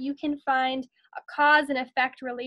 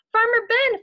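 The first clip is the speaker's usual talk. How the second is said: loud, higher call with a falling end; 8 semitones higher, 6 dB louder than usual. Acted. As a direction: call across the field to the farmer, falling at the end. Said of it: "they called".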